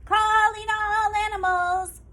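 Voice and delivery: put-on old woman calling out in a singsong; shouted call. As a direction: call across the meadow to every animal. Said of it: "shouted the old woman".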